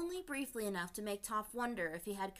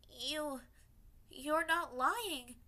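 higher, slow trembling words; lip quivering; 7 semitones higher, 4 dB louder, much slower than usual. A narrator's reading. Toph realizing her old guard is real, lip quivering. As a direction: hold back tears as it sinks in. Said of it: "her lip quivering".

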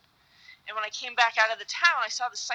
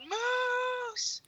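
high, drawn-out mock yell, held back in volume; imitated far-carrying call; retold yell; 4 dB quieter than usual. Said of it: "he yells out".

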